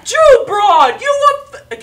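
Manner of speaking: high, very loud Italian-gentleman holler; big acted shout; yelling across a bar. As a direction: bellow it like an old guy across a bar. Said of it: "he's yelling".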